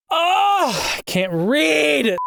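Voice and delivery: high, drawn-out exasperated yell; frustrated cry, voice crack. Real, not acted. Narrator failing to read a line, cracking frustrated yell. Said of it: "Nice voice crack".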